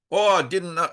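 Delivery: high-pitched attempt at a Dutch accent; badly faked Dutch accent, pitched well up; poor Dutch accent. Mentor praising her after the talk, attempted Dutch accent. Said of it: "I can't do a Dutch accent very well".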